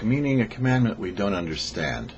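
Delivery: normal talking voice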